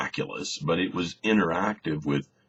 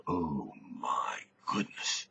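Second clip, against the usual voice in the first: slow, hushed gasp of awe; awed whisper; 4 semitones lower, 8 dB quieter, slower than usual. Acted. Disciples standing stunned after the storm stops, awed whisper. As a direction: breathe it out, stunned.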